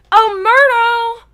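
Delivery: a huge, slow, high bellow across the room. A narrator's reading. Poltergeist taunting the ghost girl, bellowing. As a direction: haul the name out loud and long.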